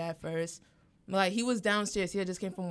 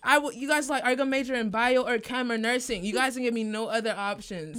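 louder, higher complaint to parents; heated outburst; 5 semitones higher, 8 dB louder than usual. Retold or imitated. Retelling an outburst at her parents, heated and loud. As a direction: let it all spill out at them.

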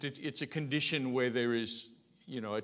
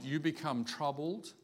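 usual speaking voice

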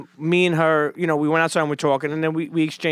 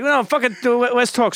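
higher, excited urging; eager reply; 6 semitones higher than usual. Retold or imitated. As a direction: push him to talk more. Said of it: "You know, like".

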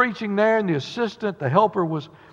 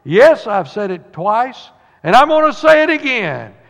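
higher, louder and slow; old preacher declaring it louder; loud proclamation; 5 semitones higher, 6 dB louder than usual. Retold or imitated. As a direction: boom it out defiantly. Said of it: "said loudly".

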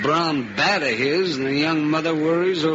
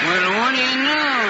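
high astonished exclamation; surprised; 10 semitones higher, faster than usual. Acted. Mac watching Eddie run past the crowd, amazed. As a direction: let it jump up in wonder.

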